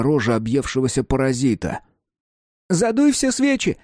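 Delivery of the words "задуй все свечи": put-on high, bright excited child call; excited shout. A narrator's reading. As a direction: cheer it out like a thrilled kid. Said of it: "восторженно гласит Марк".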